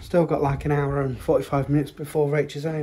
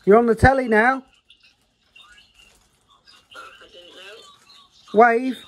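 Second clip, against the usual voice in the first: high, louder sing-song coaxing; higher and louder playful prodding; coaxing call; 7 semitones higher, 7 dB louder than usual. Real, not acted. Coaxing child to wave.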